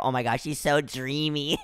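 soft, low swoon ending in a giggle; lovestruck gushing. Acted. Patient gushing about the Kylie girl, lovestruck. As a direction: drop into a quiet dreamy giggle.

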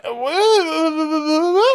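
a touch of fake sob; mock crying. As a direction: whimper the word in a high voice. Mock crying in retold scene.

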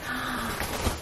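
sharp delighted gasp; excited gasp. Real, not acted. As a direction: gasp as the bird flies. Bird takes flight.

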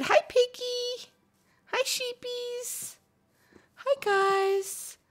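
cooing hello to the farm animals back home; pet greeting. Real, not acted. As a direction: coo at the animals like babies.

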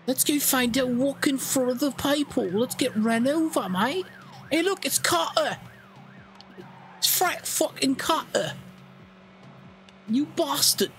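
very high, silly voice of gawking pedestrians; high cartoon voice mocking passersby; mocking bystanders. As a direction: ham up the clueless couple. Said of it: "They're like".

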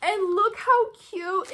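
high, slow and loud "look how cute"; cooing delight. Real, not acted. Vlogger showing viewers cute fan mail, cooing with delight. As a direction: coo over the cute envelope.

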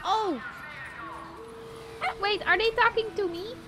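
usual speaking voice

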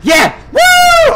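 high, loud whoop; elated yell into a long whoop; triumphant cheer. Real, not acted. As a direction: shout it in victory. Celebrates solving the puzzle.

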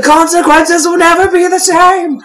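very high overblown proclamation; dramatic meme voice. Pretend. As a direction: ham up a doom announcement. Meme quote, laughing.

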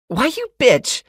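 screeched very high and loud; shrill screech. A narrator's reading. Naked blonde screeching at the neighbor, very high and loud. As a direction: shriek the insult at her. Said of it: "Screaming Barbie screeches".

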